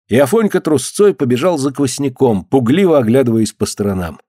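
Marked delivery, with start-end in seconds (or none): none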